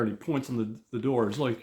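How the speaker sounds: ordinary delivery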